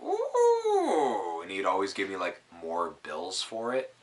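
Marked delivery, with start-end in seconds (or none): 0.0-1.4 very high, squeaky mock "Ooh"; hammed-up delighted coo; imitating delighted friend. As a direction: coo like a kid who loves coins. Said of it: "He'd be like".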